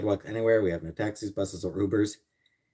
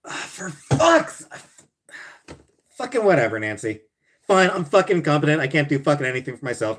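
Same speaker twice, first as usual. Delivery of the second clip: higher, louder, fast exasperated outburst; mock exasperated rant; 6 semitones higher, 4 dB louder than usual. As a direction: blow up at the chat in mock frustration. Exasperated rant at chat Nancy.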